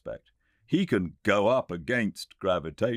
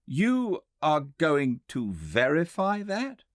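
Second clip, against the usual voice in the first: slow, higher, louder disbelief; incredulous, voiced higher; 12 semitones higher, 5 dB louder, slower than usual. A narrator's reading. Guest can't believe the claim, slow and high. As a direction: stretch out the disbelief.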